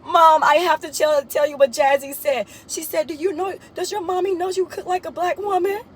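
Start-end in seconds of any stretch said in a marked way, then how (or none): 0.0-2.5 high voice, talking through the giggles; can barely get the words out for laughing. Retold or imitated. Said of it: "Chelsea just bust out laughing". 3.1-5.8 higher, put-on voice for the friend; a light, playful impression of a giggly girl; imitating Chelsea. Retelling the friend repeating her joke.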